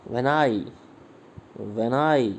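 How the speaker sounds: light "When", strong "I" in "When I"; stress on I. Shown as a demonstration. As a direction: punch the I of "When I". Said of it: "when unstressed है और आई stress है".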